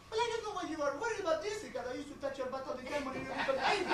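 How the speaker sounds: high-pitched fussing mom; doting mom's high voice; mother. Acted. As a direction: play an accented mother, high and quick. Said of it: "Well, ma'am".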